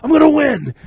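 high, loud, drawn-out yell of refusal; belligerent shout. Retold or imitated. Refusing friends who try to usher him out, belligerent shouting. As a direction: dig in and shout down your friends. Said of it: "I'm getting belligerent".

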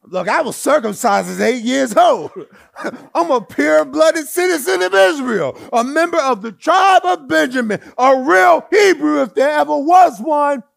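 high, loud, shouted brag; brash boasting shout in Jason's voice. Acted. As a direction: puff up and boast at full volume. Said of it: "Let me say it in Jason's voice".

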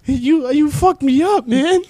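mom voice pitched very high and loud; squeaky panicked mom impression, half-laughing; a mock high-pitched mother scolding her son; imitating mom. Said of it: "She's like".

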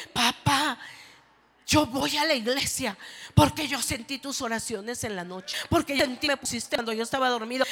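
a son thanking his father, loud and fervent; child voice. Acted.

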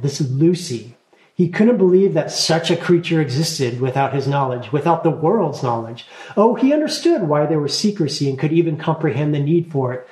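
plain reading voice

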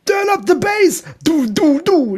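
hohe, langsame Opa-Stimme als Partystreamer; Party-Opa-Streamer. Pretend. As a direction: wie ein aufgedrehter alter DJ. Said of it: "ich werd Party-Opa-Streamer". (hohe Opa-Stimme, die zur Party anheizt)

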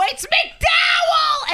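high, slow, full-throated scream; shouting the host down from the back of the room; screamed name. Retold or imitated. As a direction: defend him like a parent, at the top of your lungs. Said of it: "I just had to scream".

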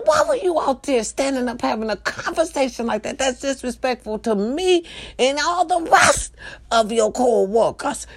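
high, theatrical scolding, slowing at the end; indignant telling-off. Retold or imitated. Manager confronting the employee walking in, high indignant retold scolding.

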